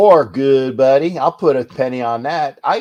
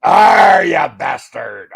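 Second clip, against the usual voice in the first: pirate growl; 2 semitones lower, 7 dB louder, much slower than usual. Pretend. Answers co-host's pirate "Arg.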